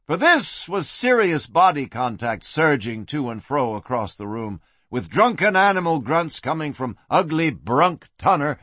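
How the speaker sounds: usual speaking voice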